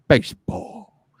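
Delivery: slow, drawn-out impression of a famous actor's voice; bad James Earl Jones. Host tips hats off to baseball, bad actor impression. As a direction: stretch the word out in an amateur celebrity impression. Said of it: "That was my bad James Earl Jones impression".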